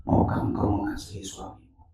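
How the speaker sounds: soft, low imitation of God; God's gentle question. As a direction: ask it tenderly, almost hushed. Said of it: "Dia bilang begini". (suara Tuhan bertanya dengan lembut)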